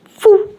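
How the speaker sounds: high, puffed whoosh noise; portal whoosh. Acted. Narrator sounding the portal swallowing the mother, a quick whoosh. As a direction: blow out a sudden whoosh as the portal opens. Portal opens under her.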